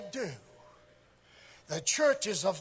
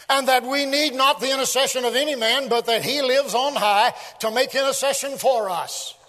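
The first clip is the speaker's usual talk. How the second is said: loud, raised proclamation; full-force preaching; 5 semitones higher, 8 dB louder than usual. Real, not acted. Preacher declaring one mediator to the congregation, full-force preaching. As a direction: drive it out to the whole congregation.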